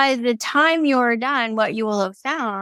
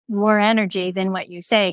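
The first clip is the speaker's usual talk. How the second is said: normal talking voice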